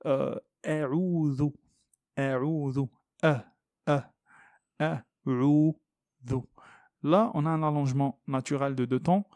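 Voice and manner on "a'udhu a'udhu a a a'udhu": "a'udhu" slow, thin alif, dh like English th; drawn out syllable by syllable; drilled pronunciation. Shown as a demonstration. Teacher drills "a'udhu" slowly for students. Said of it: "comme je l'ai expliqué".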